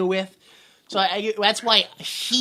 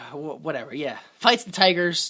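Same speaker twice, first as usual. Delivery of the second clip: usual speaking voice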